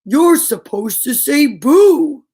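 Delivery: loud, slow bovine scolding; slow cow voice. Acted. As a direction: moo out the lecture. Cow lectures Ben.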